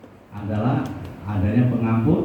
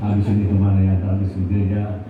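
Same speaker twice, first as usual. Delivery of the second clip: lower, idly musing churchgoer voice; imitating a churchgoer; 4 semitones lower than usual. Preacher mimics a bored churchgoer after service, musing. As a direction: play a restless congregant thinking of food.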